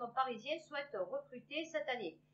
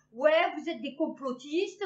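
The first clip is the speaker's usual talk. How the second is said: slow, louder mocking jab; mock accusation; 9 dB louder, slower than usual. Parodying critics who call them conspiracy theorists, slow and loud.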